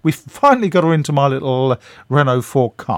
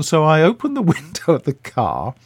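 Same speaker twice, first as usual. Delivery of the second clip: ordinary delivery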